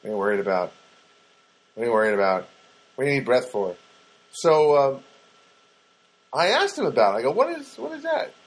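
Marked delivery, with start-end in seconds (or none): 0.0-3.8 mildly dismissive, lower-pitched brush-off. Pretend. 7.3-8.3 puzzled question. Retold or imitated. Acted question to the neighbor.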